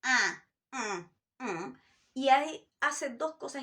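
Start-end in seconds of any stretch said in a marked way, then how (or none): 0.0-1.8 ah and hum pushed into the nose; nasal twang. Shown as a demonstration. Teacher showing twang resonance in the nose. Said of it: "colocar tu voz en resonancia con la nariz".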